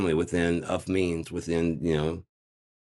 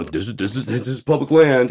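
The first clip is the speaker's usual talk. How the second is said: mock insistent agent; 7 semitones higher, 8 dB louder than usual.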